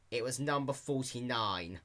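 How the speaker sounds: plain reading voice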